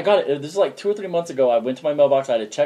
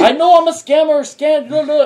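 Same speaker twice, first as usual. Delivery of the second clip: much higher, louder parody rant; mock scammer rant; 12 semitones higher, 9 dB louder than usual. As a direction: rattle it off as the scammer, hammed up. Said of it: "thick ass Indian accents".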